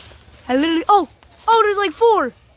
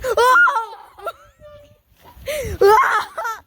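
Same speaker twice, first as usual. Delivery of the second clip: yelping; 7 semitones higher, much slower than usual. Real, not acted. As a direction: cry out while bouncing. Yelps while bouncing.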